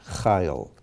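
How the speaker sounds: Chayil accented on CHA, ending low; stress at the start. Shown as a demonstration. Teacher repeating the exception, Chayil stressed on CHA. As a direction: lean on the opening syllable of Chayil. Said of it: "accent on the first letter".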